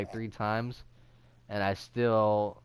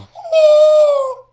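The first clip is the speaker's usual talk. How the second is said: high screechy goblin cackle; goblin laugh; 19 dB louder than usual. Real, not acted. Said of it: "Why did you sound like a goblin?".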